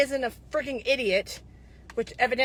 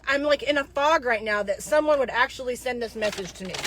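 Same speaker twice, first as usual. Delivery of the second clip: ordinary talk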